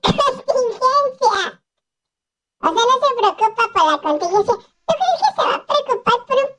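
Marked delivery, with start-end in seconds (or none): 0.0-1.4 high, drawn-out word through laughter; laughing self-correction. Real, not acted. Host correcting own slip, laughing and drawn out. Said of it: "¿por qué dije contingencia?".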